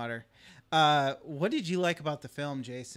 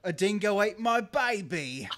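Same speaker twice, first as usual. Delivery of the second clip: slow, higher mock Australian accent; 6 semitones higher, 4 dB louder, slower than usual. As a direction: parody Australian, drawn out. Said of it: "horribly offensive stereotypes".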